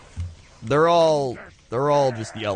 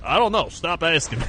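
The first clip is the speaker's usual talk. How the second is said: annoyed brush-off; 7 semitones higher than usual. Retold or imitated. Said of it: "And then they're like".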